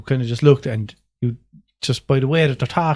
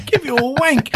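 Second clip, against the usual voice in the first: put-on northern accent; 10 semitones higher than usual. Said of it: "I like the northern accent".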